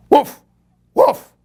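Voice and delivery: loud, sharp woofs like a dog; barking dog. A narrator's reading. The dog chases the skeleton, barking loudly. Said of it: "barked the dog".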